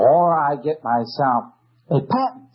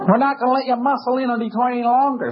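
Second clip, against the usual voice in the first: mock tough-guy judge, higher, with a laugh; imitating the judge; 8 semitones higher than usual. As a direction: lay the judge's warning on, pitched up and laughing. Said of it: "And he says".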